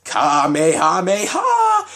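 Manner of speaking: slow, high, loud anime attack call; shouting the Kamehameha while laughing. Retold or imitated. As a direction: drag out the attack name like firing a beam. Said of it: "And he says".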